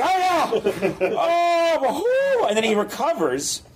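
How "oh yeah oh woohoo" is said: high-pitched mock wailing in fright; hammed-up panicked cries; scared professor. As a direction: wail like you've seen a skeleton. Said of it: "he gets just as scared each time".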